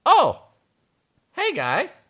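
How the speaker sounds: high, sheepish cartoon greeting; surprised mole. Mole popping out to face the rocks, high surprised greeting. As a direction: greet them high, caught off guard.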